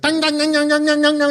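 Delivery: high, rapid nonsense jabber; mock squawking. Director imitating the front man, high mock squawk. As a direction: squawk out gibberish syllables. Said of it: "So he squawks".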